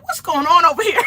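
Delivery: high, loud, laughing bewilderment; loud high-pitched mock confusion; mock alarm. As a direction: retell a startled demand, half laughing. Retells own alarmed reaction to screaming.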